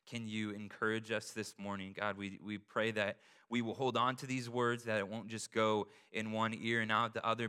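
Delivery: low, hushed opening prayer. Real, not acted.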